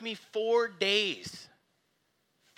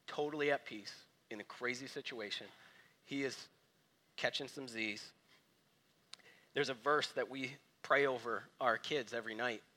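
soft reflective preaching; 8 semitones lower, 6 dB quieter than usual. Acted. Soft reflective sermon stretch.

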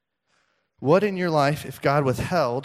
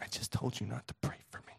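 under the breath, barely voiced; muttered objection; 3 semitones lower, 16 dB quieter than usual. Listener privately objecting to the pastor's prayer, muttered. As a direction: mutter a near-whispered private complaint. Congregation's imagined grumble after the prayer.

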